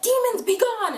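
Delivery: high, mock-grand banishing cry; commanding shout. Clary sending demons away, commanding shout.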